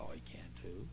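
soft breathy whisper. Retold or imitated. Preacher answering the worried mother in a whisper. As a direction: whisper it knowingly.

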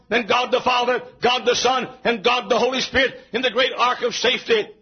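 high, chant-like pulpit phrases; full-force preaching. Real, not acted. Preacher listing types of the cross, full-force preaching. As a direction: punch out each phrase on a high pitch.

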